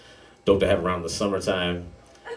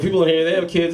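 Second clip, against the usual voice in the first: ordinary delivery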